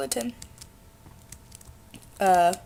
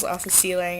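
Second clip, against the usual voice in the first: ordinary delivery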